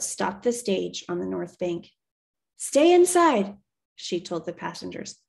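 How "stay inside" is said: high, loud command; firm called order. Acted. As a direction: call it out over the rain so they stay put. Driver ordering passengers in storm.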